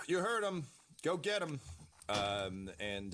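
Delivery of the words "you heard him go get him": high-pitched acted command; acted battlefield order, pitched high; Skullcrawler giving orders. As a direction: call the troops forward, high and urgent. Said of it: "you hear Skullcrawler be like".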